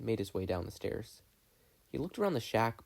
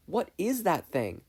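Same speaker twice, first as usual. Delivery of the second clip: put-on astonished old man; 8 semitones higher, 4 dB louder, slower than usual. Ford sees the worm.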